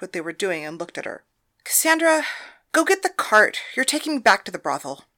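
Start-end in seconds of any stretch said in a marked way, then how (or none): 1.6-5.1 higher, louder command to a servant; madam orders the girl to fetch the cart, commanding; barked order. Acted. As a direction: snap out the order, no room for argument. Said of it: "Thalia ordered".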